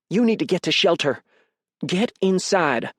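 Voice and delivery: high-pitched, urgent plea; urgent, alarmed. A narrator's reading. Mother urging her son to shelter, alarmed. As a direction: press him to move, slowing on the order.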